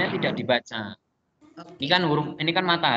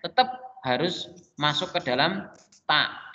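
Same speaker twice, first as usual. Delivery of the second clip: ordinary delivery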